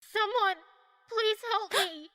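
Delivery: faint, pained moans; barely able to call out, hurt; weak and in pain.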